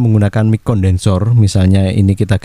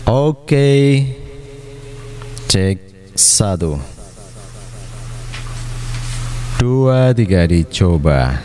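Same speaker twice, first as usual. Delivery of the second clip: cathedral echo; 4 dB quieter, much slower than usual. Shown as a demonstration. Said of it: "Ini level untuk ekonya".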